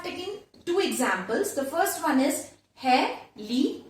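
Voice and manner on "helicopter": "helicopter" in four spaced beats; syllable by syllable. Shown as a demonstration. Teacher shows a four syllable example, syllable by syllable. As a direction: break "helicopter" into he, li, cop, ter. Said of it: "Four syllables".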